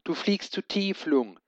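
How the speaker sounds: hoher besorgter Ruf; besorgt gerufen. A narrator's reading. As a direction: den Drachen warnen. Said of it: "rief Schwefelfell". (Schwefelfell ruft dem Drachen warnend zu)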